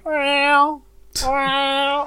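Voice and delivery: high imitated meow; imitating a cat's meow. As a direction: mew like a hidden cat. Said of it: "I hear".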